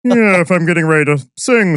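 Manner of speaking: head resonance. Shown as a demonstration. Showing the hosts a different resonator. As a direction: project up into the head like before singing. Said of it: "I can easily go here versus".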